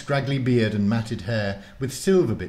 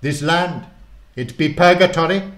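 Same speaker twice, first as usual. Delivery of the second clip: put-on loud, higher grand old warlock; 8 semitones higher, 10 dB louder than usual. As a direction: announce himself with old-world pomp. Murden speaks in story.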